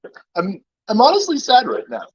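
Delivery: high, whiny fake sadness; mock sad voice. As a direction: pout and play heartbroken. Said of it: "this is my sad face and my sad voice".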